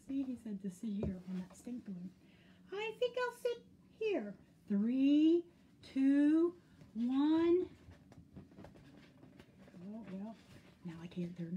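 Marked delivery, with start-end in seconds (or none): 2.7-4.4 very high, innocent bear voice; singsong, pitched way up. A narrator's reading. Bear sits on the balloon, high and unsuspecting. 4.7-7.7 loud, higher, rising numbers; countdown. A narrator's reading. Waiting for the pop, loud suspenseful countdown.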